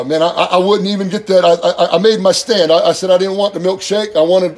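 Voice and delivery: usual speaking voice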